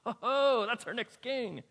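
mock awed prophet; impressed Samuel. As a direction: gush over the tall son. Samuel impressed by eldest son.

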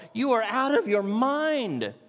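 slow, high, loud outburst; loud exclamation. Acted. Festus shouting at Paul in court, loud and slow. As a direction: Festus bursts out at the prisoner. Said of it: "exclaims in a loud voice".

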